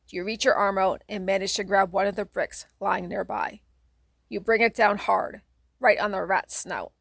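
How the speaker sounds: usual speaking voice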